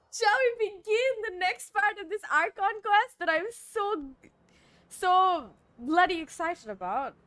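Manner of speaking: excited, slightly put-on British accent; half British accent. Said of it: "I suddenly have a British accent".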